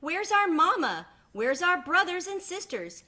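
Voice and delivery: high, loud worried duckling voice; ducklings crying out. Acted. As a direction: plead for mama like lost little ducks. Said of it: "they cried".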